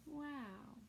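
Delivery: ordinary delivery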